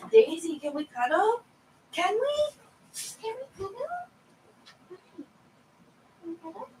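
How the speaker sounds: put-on high squeaky baby talk to the cat; pet talk. To her cat Daisy, high cooing pet talk. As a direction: coo it up high like talking to a pet.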